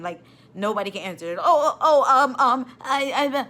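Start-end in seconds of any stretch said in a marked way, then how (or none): 1.4-3.4 high, loud, laughing mock stammer. Nurse mocking staff who can't answer, laughing. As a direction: imitate them fumbling for an answer.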